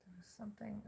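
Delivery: breathy whisper to himself. Real, not acted. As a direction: drop to a whisper, musing. Musing aloud to himself.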